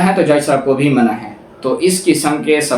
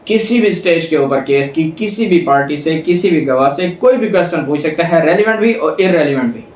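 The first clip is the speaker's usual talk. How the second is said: ordinary delivery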